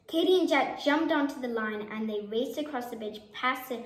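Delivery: ordinary delivery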